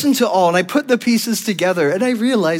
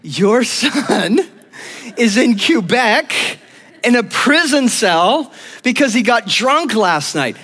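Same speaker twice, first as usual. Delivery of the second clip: higher, slowed-down report of shocking news; mock scandalized grandmother; 6 semitones higher, 3 dB louder, slower than usual. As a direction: break the scandal to his mother, dramatically. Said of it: "And she tells my mom".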